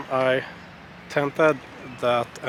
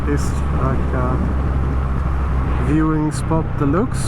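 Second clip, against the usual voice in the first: muffled helmet mic, louder voice; talking through a motorcycle helmet; inside a helmet; 5 dB louder than usual. Real, not acted.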